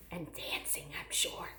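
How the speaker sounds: breathy aside under the breath; whispered aside. Real, not acted. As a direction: slip it in as a secret.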